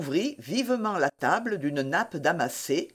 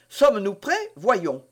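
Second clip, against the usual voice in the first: ordinary delivery, nothing marked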